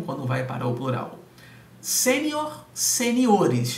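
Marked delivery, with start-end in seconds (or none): 1.8-3.8 "Sênior" high and punched on SÊ, then "seniores" louder with the stress moved to -NIO-; stressed syllable shown. Shown as a demonstration. Teacher to exam students, showing the stress moving with careful emphasis. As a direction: mark where the stress falls in each word. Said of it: "Percebam que eu marquei para vocês aí que a sílaba tônica mudou".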